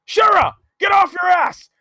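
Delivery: high, drawn-out mock holler; hammed-up shout. Imitating Sid's outburst after the president's call, shouting. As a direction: bellow it like a space-obsessed hothead. Acted outburst of Sid in story.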